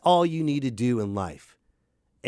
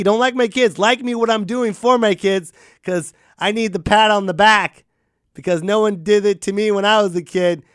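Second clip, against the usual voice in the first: higher, louder bragging parent; mock show-off parent; 4 semitones higher, 6 dB louder than usual. A parent posting kid pics, begging for likes.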